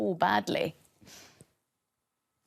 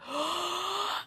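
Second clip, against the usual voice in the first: startled gasp; 10 semitones higher, 4 dB quieter than usual. Acted. Bear hears them.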